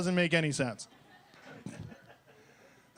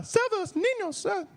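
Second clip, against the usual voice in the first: put-on pleading kids; 14 semitones higher than usual. Kids begging Mister Beast for food, high child voice. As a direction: beg like a hungry little kid. Kid voice continues.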